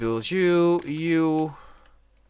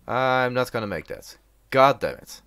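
ordinary delivery

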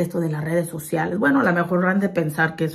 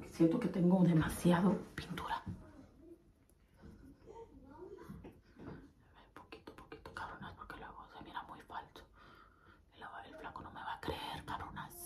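very soft whisper; barely audible, secretive; 14 dB quieter than usual. Real, not acted. Hiding in the bathroom.

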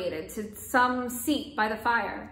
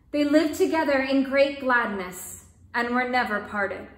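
measured, expressive storybook reading; story narration; 4 dB louder, slower than usual. A narrator's reading. Storyteller reading a folk tale aloud, calm narration. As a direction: tell the tale gently to listeners.

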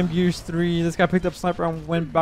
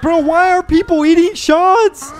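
high, slow, loud incredulous whine; exasperated disbelief; 11 semitones higher, 6 dB louder, slower than usual. Retold or imitated. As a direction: stretch it out like you can't believe it. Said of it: "I'm like".

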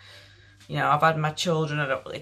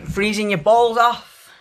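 loud, slow and raised in pitch; hammed-up exclamation; 4 semitones higher, 9 dB louder than usual. Vlogger telling viewers about cold gym trips, hammed-up exclamation. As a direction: draw it out loudly for comic effect. Dramatising being freezing before gym.

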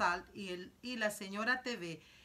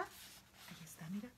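susurro; 12 dB quieter than usual. Real, not acted. Reader shows listeners the squirrel.

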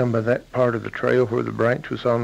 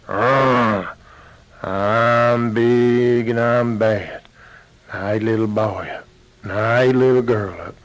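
big bad bear; 3 semitones lower than usual. Bear threatens the daddy.

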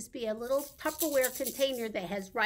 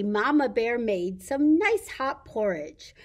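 normal talking voice

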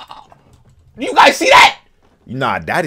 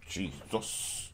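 hushed, fading pleading; whispered plea; 10 semitones lower, 13 dB quieter, slower than usual. Real, not acted. Watching his favourite lose, whispered begging plea. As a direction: beg under your breath as it gets tense.

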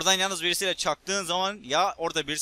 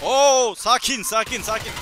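high, loud burst easing off; startled call for everyone to calm down; loud "whoa, easy" under fire; urgent calming; 5 semitones higher, 7 dB louder, slower than usual. Real, not acted. Reacting to sudden enemy fire.

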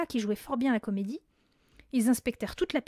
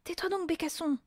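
higher-pitched scolding; sharp retort; 7 semitones higher than usual. A narrator's reading. Mathilda silencing the hidden parrot, sharp retort. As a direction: shush the bird crossly. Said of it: "riposta Mathilda".

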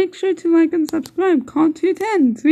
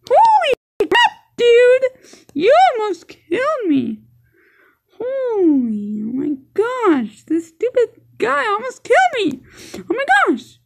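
shocked outburst; 7 semitones higher than usual. Real, not acted. Nearly killed in game.

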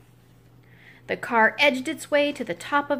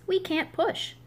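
chickens' voice; 7 semitones higher, 3 dB quieter than usual. Acted. Chickens refusing to push.

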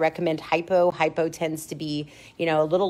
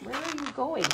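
high, softer pet-talk question; soft high-pitched dog talk; 8 semitones higher, 5 dB quieter than usual. Real, not acted. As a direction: repeat it high and softly to the dog.

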